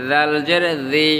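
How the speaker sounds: 'জি' as a voiced th; tongue-tip Dhal. Shown as a demonstration. Teacher repeats for learners, 'জি' from the tongue tip. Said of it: "জিহ্বার আগা থেকে".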